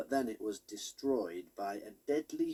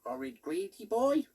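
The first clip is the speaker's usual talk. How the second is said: high squeaky toy pig, put out; 10 semitones higher, 5 dB louder than usual. A narrator's reading. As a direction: play the knitted pig toy, a bit offended. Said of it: "said Dog Hog".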